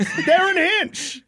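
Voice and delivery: slow, high, shouted name; announcer shout. Acted. Hyping Australia's own senator with a slow shout.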